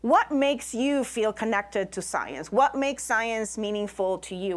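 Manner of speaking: ordinary delivery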